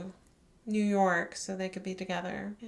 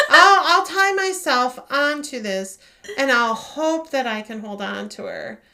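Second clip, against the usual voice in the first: mock self-talk; 5 semitones higher, 10 dB louder than usual. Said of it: "I was so confused on".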